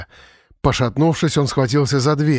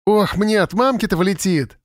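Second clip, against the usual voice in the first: put-on high-pitched boyish lament; worried village boy; 7 semitones higher than usual. A narrator's reading. Village boy dreading his mother's scolding, lightly worried. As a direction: lament it higher, like a boy in trouble. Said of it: "вторил ей Генка".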